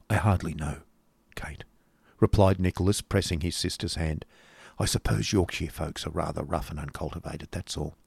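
soft, low, confiding voice; subdued reply. Acted. Brother privately reassuring his sister, subdued. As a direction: murmur it low and quiet.

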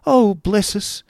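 put-on high startled old-lady exclamation; high squeak of surprise as Miss La Creevy. A narrator's reading. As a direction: jump at the surprise. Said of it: "exclaimed Miss La Creevy".

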